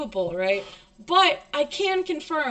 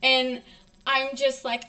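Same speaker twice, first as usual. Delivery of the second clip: ordinary talk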